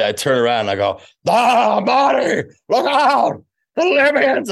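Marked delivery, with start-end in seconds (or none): 1.3-4.5 much higher, louder frantic cry; panicked Doc Brown warning Marty. Retold or imitated. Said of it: "my best Doc Brown".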